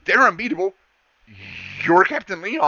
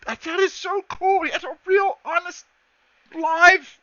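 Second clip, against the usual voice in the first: much higher, gushing awe; excited gushing; 13 semitones higher, 3 dB louder than usual. Acted. The girl gushes to Max, much higher and excited. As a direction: gush over the robot like a fan.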